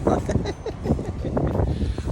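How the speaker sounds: breathy high-pitched chuckle; airy high giggle. Real, not acted. Laughing at selling own cars.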